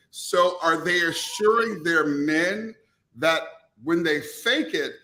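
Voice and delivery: ordinary talk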